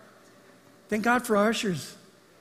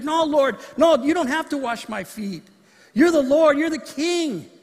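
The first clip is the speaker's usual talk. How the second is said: pleading protest; 8 semitones higher, 6 dB louder, slower than usual. Pretend. Congregant refusing Jesus washing feet.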